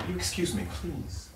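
soft plea. Acted. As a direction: trail off into a breathy plea. Boyfriend pleads after apology.

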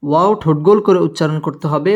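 usual speaking voice

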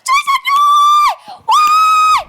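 high, loud, hammed-up cries for help; wife crying out that her husband is being stolen; hammed-up wail for help. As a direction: wail to the neighbours like a victim.

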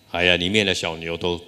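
ordinary talk, nothing marked

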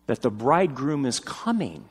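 ordinary delivery

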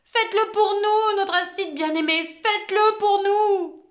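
high, loud bawling chant, slow; whole class bawling at their teacher. Acted. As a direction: bawl it like a starving crowd. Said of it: "braillant comme des affamés".

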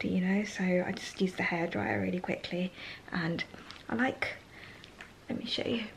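hushed whisper so nobody wakes; soft whisper, almost no voice. Real, not acted. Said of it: "whispers, people asleep".

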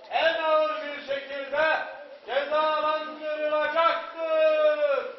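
slow, loud, high proclamation; town crier. Acted. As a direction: proclaim it across the square.

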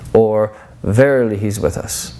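normal talking voice

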